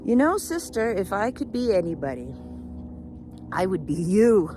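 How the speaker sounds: high, loud, delighted declaration; emphatic revelation. Acted. Peacock reveals its wish to raven, loud and delighted. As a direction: proclaim it with a big push on the end.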